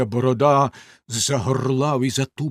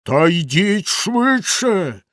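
slow, higher bellowing; roaring; 6 semitones higher, 5 dB louder, much slower than usual. A narrator's reading. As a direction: drag the order out loud. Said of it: "знову заволав".

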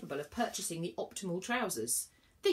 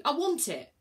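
louder, higher impatient demand; rude demand; 8 semitones higher, 7 dB louder than usual. A narrator's reading. Inspector rudely demands Virgo's ticket. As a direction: push her for it. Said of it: "snapped the m-, mortal rudely".

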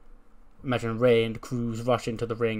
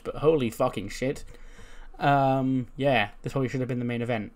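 ordinary talk

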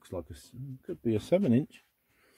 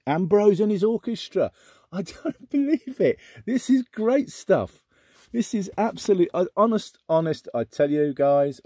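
much higher and louder, bursting with delight; can't believe the luck, gushing; excited; 10 semitones higher, 5 dB louder than usual. Real, not acted. Finding a treasured record, thrilled and gushing. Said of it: "Sorry, I'm getting so excited".

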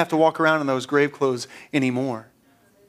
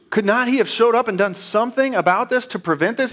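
raised, louder challenge from the crowd; challenging question; 9 semitones higher, 5 dB louder than usual. Retold or imitated. Onlookers question why Jesus didn't prevent this, challenging. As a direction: put the pointed question to him, higher.

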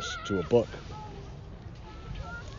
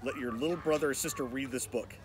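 soft, secretive storyteller warning; hushed warning; 9 dB quieter than usual. A narrator's reading. Narrator quietly warning young listeners of danger. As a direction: lean in and warn the reader in a hush.

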